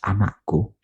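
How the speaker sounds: put-on soft, intimate call to a child; tender call. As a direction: murmur it like a loving father. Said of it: "dia panggil Yesus". (lembut seperti bapa memanggil anaknya)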